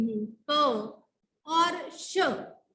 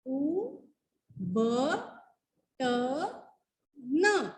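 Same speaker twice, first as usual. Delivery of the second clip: letter by letter; 4 dB quieter, much slower than usual. Shown as a demonstration. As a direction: sound out ख ट म ल with pauses. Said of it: "उबटन". (एक एक अक्षर रुककर)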